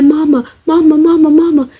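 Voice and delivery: high, loud panicked cries of mama; screaming for mama. Retold or imitated. Scared girl on the phone screaming for her mama. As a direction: scream for your mother in panic. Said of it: "The second I screamed".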